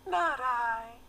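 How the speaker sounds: very high squeaky little rat. A narrator's reading. The rat refusing to thresh, high squeak. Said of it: "with a squeak".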